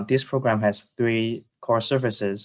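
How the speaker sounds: ordinary talk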